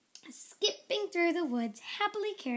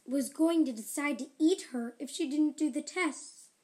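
ordinary delivery, nothing marked

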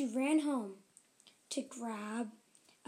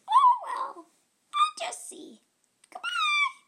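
very high, louder chirpy ant; 8 dB louder than usual. Acted. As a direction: say goodbye like a tiny ant. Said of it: "said the ant".

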